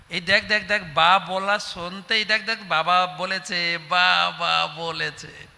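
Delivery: the last বাবা বলেছে drawn out slowly with joy; delighted parent. Pretend. Speaker playing a parent thrilled as the baby first says বাবা, excited.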